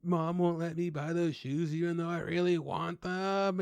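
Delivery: slow, high whine; mock a sulking teenager; whiny kid. Imitating a crying kid complaining to his dad about shoes. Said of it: "the kid's just like".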